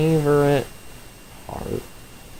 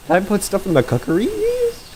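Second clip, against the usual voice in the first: high cracking squeak on purpose; mock voice crack; 8 semitones higher than usual. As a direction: imitate his own voice crack, pitched up. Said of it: "Random ass voice crack".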